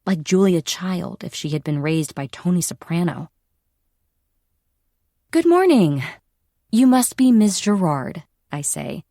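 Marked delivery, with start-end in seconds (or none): 5.3-8.2 high, loud, beaming greeting; bright PR greeting. Acted. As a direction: sell it with a big smile. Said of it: "my best and brightest P R smile".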